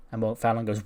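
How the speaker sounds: ordinary talk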